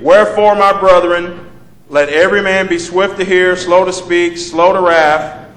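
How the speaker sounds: preacher reading scripture aloud. Acted. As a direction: proclaim the verse to the congregation.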